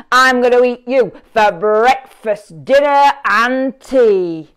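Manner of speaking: loud, slow troll growl with the last words dragged; a greedy troll bellowing down from under the bridge; rumbling troll. A narrator's reading. Troll threatening the little goat, loud slow rumble. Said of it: "thundered the troll".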